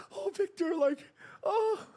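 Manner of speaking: put-on high, starstruck squeal of a young fan; giddy kid. Young fan seeing Victor Cruz, giddy high squeal. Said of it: "I was like".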